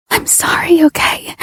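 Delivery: breathless urgent whisper; whispered apology. Acted. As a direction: whisper it against his face. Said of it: "whisper my own apologies".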